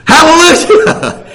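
loud slow joyful shout; full-voiced hallelujah. Real, not acted. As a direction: burst out in praise. Preacher shouting praise.

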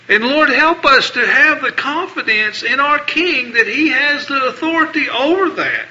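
usual speaking voice

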